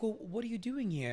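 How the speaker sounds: high, curious British woman; British accent. Retold or imitated. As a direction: imitate a British lady making conversation. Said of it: "in her British accent".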